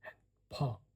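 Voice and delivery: a mother's voice starting to crack into panic; mild hysteria creeping in; edge of hysteria. A narrator's reading. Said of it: "She heard the hysteria edging her voice".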